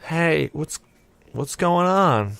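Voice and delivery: lightly voiced sleepy child; groggy child. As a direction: imitate a kid just coming round, slowly. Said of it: "she rubs her eyes".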